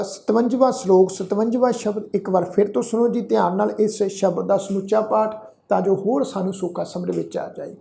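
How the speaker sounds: ordinary talk, nothing marked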